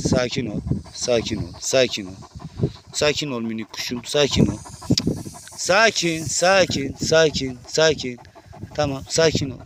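soft, low, calming murmur; calming the agitated snake with soft, low repetitions; soothing. Real, not acted. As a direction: hush the snake gently.

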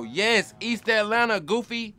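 exasperated shout. Real, not acted. Exasperated at friend's ignorance.

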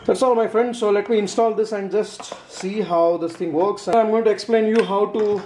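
ordinary talk

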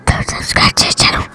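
sneaky whispered aside; whispering. Pretend. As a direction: whisper it like a secret. Joking aside to viewers.

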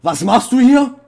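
sudden high shriek of fright; jump-scared, shriek the question; startled scream. Real, not acted. Gamer startled by a figure on the horror server, shrieking.

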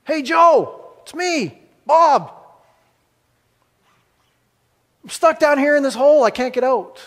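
thrilled to see a friend, high and bright; excited. Acted. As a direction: greet your buddy with delight from the hole. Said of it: "And he's so excited".

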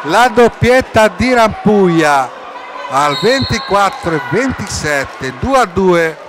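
high, loud, drawn-out goal shout; breathless italian goal commentary. Real, not acted. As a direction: scream the goal name, stretching it. Goal scored, commentator erupts.

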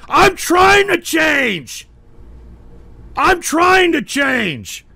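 slow, high, loud protest; exasperated shout. Real, not acted. Host answers chatter doubting his change, shouting exasperatedly. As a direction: yell it back, stretching every word. Yells back at a chat jab.

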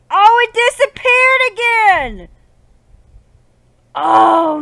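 high, loud wail of frustration; exasperated outcry. Real, not acted. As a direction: cry out in drawn-out exasperation. Lighter vanishes in game.

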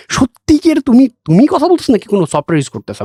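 high-pitched imitated disbelief; surprised. British man asking if software makes the accent, surprised. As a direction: act out an incredulous question, starting very high. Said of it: "he was so surprised".

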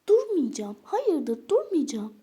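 loud, high defiant refusal; defiant shout. Pretend. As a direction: spit out the refusal.

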